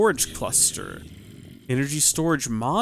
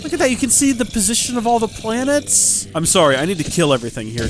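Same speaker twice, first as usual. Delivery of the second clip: ordinary talk, nothing marked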